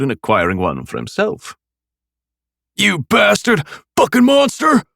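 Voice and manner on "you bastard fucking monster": high furious scream. A narrator's reading. Swordsman charging the armored killer, screaming. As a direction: shriek the curse at the monster. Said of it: "screamed the swordsman".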